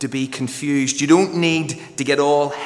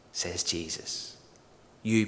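normal talking voice

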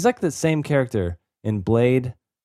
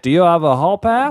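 high louder hall monitor; snippy teacher voice; lightly voiced villain; mock strict; 8 semitones higher, 5 dB louder than usual. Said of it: "He's like".

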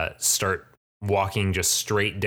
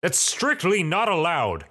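high-pitched, affronted protest; indignant priest; 10 semitones higher than usual. Acted. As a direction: forbid it in acted outrage. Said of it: "this violent outburst in a holy space".

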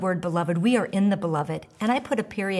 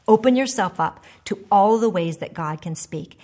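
usual speaking voice

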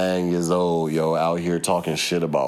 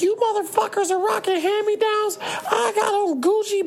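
very high mocking little-girl voice; squeaky bratty kid bragging, imitated; high mock child voice flexing her clothes. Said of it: "She's like".

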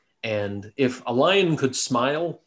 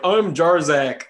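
mock elvish voice; 4 semitones higher, 7 dB louder, slower than usual. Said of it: "in elvish, be like".

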